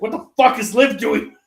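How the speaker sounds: high, loud retold outburst; mock outrage. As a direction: exclaim like an aunt catching someone out. Retold outraged aunt bursting out.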